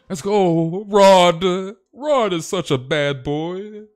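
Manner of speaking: high, loud, slow mock swoon; swooning girls. Streamer mocking girls who like bad boys, in a hammed-up swoon. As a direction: imitate girls fawning over a bad boy. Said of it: "why do these freaking girls".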